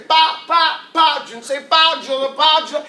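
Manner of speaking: punchy repeated syllables, imitating a child learning; imitated child's sound practice; child practicing sounds. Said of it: "They're practicing".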